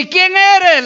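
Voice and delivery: acted climber calling up; questioning climber. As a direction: demand to know who is up there. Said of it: "le dice el alpinista".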